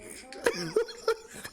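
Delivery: high, airy helpless laugh; real giggling fit. Real, not acted. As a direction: let the laugh run out of breath. Cracking up at memes.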